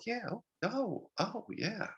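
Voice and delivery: high, slow, soft mock-interested oh yeah; fake enthusiasm. Nodding along to a classmate's beer can pitch, feigned high soft interest. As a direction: humor him with a drawn-out, high-pitched yeah.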